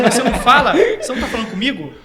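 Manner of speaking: joking, laughing mock disbelief; amused incredulity. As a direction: laugh while mimicking a baffled reply. Jokingly quotes his reply amid laughter.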